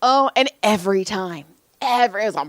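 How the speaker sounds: ordinary delivery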